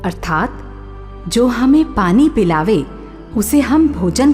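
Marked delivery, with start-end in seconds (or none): none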